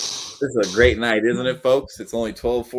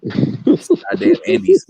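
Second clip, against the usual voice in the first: mock outrage; 7 dB louder, much slower than usual.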